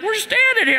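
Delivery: very high imitation of kids; kids protesting. Kids answering their yelling dad, high-pitched mock protest. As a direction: put on a high, protesting kid voice.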